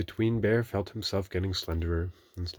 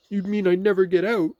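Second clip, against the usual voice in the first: Pooh; 13 semitones higher, 10 dB louder than usual. A narrator's reading. Pooh voiced, worried.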